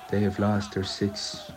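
ordinary talk

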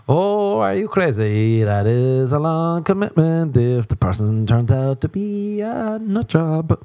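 high, drawn-out disbelief; mock incredulity. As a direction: stretch it out as if the idea were madness. Mock disbelief at meal dates.

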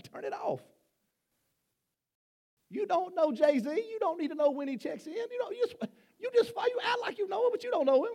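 high, laughing teasing; mock the celebrity fans with a laugh; laughing tease. Real, not acted. Teasing about [name].

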